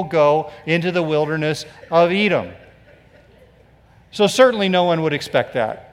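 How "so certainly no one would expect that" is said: ordinary talk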